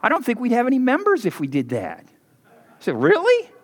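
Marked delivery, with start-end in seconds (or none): 3.0-3.5 high rising mock astonishment; mock surprise. Pastor feigning shock to congregation, high rising. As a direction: squeak it out in fake disbelief.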